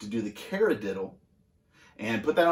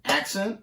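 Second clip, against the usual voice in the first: "accent" lifted high with a rising end; accented call-out; 7 semitones higher than usual. Shown as a demonstration. Drum teacher shows when to hit the accent, accented call-out.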